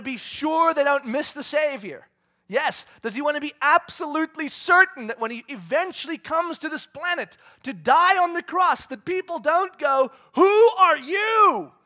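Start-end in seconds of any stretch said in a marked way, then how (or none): 3.0-10.1 higher, louder building question; rising preaching. Real, not acted. Preacher pressing the congregation, building loud. 10.3-11.7 high, slow, loud bewildered shout; mock baffled shout. People not recognizing the Savior, shouted in mock confusion.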